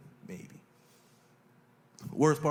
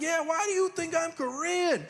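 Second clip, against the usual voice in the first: disbelieving, pitched way up; baffled. Retold or imitated. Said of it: "I was like".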